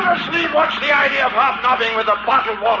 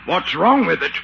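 normal talking voice

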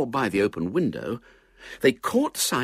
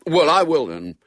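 high, loud show of boldness; reckless bravado; 7 semitones higher, 8 dB louder than usual. A narrator's reading. Boy answering his reluctant squire, with bravado. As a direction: pluck up reckless bravado, louder and higher. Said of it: "said William with reckless bravado".

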